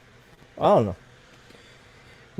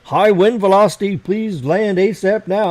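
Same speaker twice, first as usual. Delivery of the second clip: loud, raised voice for an automated warning; imitated drone alert; 8 semitones higher, 7 dB louder, slower than usual. Pilot quotes his drone's wind warning, loud mock alert. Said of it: "It kept saying".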